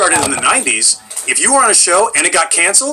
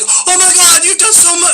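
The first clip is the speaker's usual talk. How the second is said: much higher, gushing fan amazement; mock fan gush; 14 semitones higher than usual. Internet fans reacting to his credits, gushing mock voice. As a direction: gush like an impressed fan online. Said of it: "people will just look at I M D B and say".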